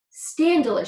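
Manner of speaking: loud high outraged shout; a king's scandalized shout. A narrator's reading. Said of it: "shouted the king".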